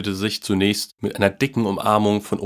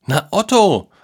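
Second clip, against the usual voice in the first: put-on higher, louder, ready boyish reply; prompt answer; 10 semitones higher, 6 dB louder than usual. A narrator's reading. Boy answers his parents at once, prompt and high. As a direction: answer straight away, pitched up. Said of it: "antwortete der Junge prompt".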